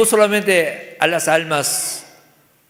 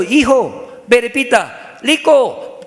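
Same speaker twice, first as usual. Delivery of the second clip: higher, insistent father drilling a word; emphatic dad with L for R; dad teaching; 7 semitones higher, 4 dB louder, slower than usual. Acted. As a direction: make the son repeat "lico". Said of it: "papá no puede pronunciar rico".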